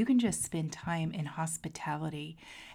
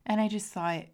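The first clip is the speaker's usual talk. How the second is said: ordinary delivery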